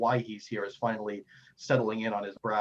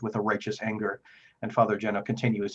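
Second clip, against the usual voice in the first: ordinary talk, nothing marked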